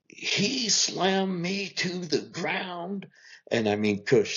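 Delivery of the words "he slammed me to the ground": high, drawn-out wail, held back in volume; mock screamed accusation, high and slow. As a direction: imitate a daughter's screamed accusation, stretched out slowly. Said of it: "just screams. Everyone in the house screamed".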